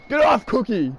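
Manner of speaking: high urgent yell; urgent shout. Real, not acted. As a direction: shout to shake someone off. Shouting at attacker in game.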